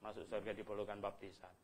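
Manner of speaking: a soft, scornful repeat; quiet mocking echo. Pastor quietly echoing the churches' claim with scorn. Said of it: "dia bela-bela bahwa".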